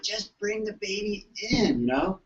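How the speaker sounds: slow, drawn-out mock plea; slow mock pleading. Chiropractor mimicking himself to the family, slow. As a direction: coax them gently, drawn out. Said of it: "I'm like".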